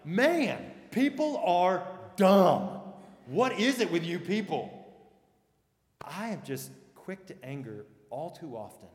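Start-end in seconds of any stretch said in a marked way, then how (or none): 0.0-4.8 louder, higher exasperated outburst; irritated rant. Retold or imitated. Pastor imitating himself irritated by Facebook posts. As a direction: blow up like someone fed up on Facebook. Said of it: "I'm like".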